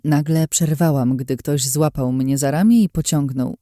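usual speaking voice

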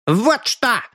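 put-on high, defiant street boy; a scruffy brat squaring up. Captured boy stands up to the detective, defiant.

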